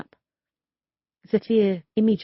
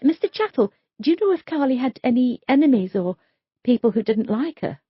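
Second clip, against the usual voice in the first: lightly voiced young detective, a bit higher; polite, careful questions from the detective; Sophia's voice; 6 semitones higher, 4 dB louder than usual.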